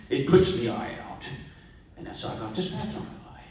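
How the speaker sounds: pirate voice. Acted. Pirate telling the bar patron about the bird, in a loud hammed-up pirate voice. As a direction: ham up the old sea-dog's tall tale.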